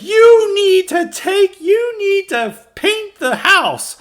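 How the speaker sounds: high, loud, slow mock scream of a bossy wife. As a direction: shriek the orders like a nagging wife. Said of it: "the wife just screams at him".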